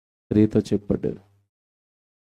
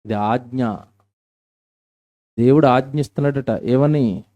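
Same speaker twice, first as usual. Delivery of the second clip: ordinary talk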